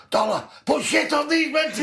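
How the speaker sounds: high, loud shout across a pitch; imitated shout. Teammate shouting from the centre to Dollar, high imitated shout. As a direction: yell it out to a teammate, cracking up after. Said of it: "will shout from the centre".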